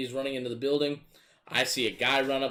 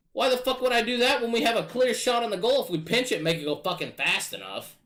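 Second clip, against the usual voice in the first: high, loud, rapid-fire rant; frustrated outburst; 10 semitones higher, 4 dB louder than usual. Real, not acted. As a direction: vent the frustration at full volume. Frustrated outburst about the play.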